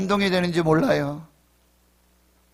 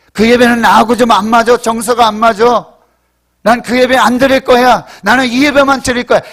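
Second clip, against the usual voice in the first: loud, defiant mock complaint; stubborn refusal; 4 semitones higher, 10 dB louder than usual. Preacher mimicking a choosy worshipper, loudly stubborn. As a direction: refuse loudly, digging your heels in.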